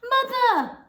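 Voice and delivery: high, loud cry of alarm. A narrator's reading. Missus Bucket crying out to her shrinking mother in alarm. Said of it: "cried Missus Bucket".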